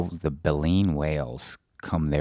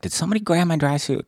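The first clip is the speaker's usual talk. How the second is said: alarmed question; 12 semitones higher, faster than usual. Retold or imitated. Retelling searching for missing gear.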